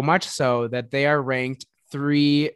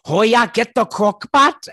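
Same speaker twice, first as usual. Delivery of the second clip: put-on higher, louder, slow Midwestern accent; 8 semitones higher, 8 dB louder than usual. Tipsy at dinner with her boss, a slow, loud Midwestern accent. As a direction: ham up a Midwestern twang, slowly. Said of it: "Midwestern Vegas accent came out".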